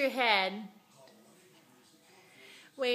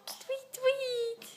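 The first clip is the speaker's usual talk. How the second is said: squeaky bird chirp, much higher; high chirpy tweet; 12 semitones higher than usual. Shown as a demonstration. Mother to toddler, high bird tweet. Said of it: "What's a bird say?".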